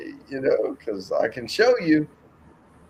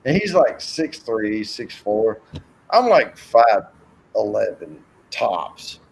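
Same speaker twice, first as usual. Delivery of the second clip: ordinary delivery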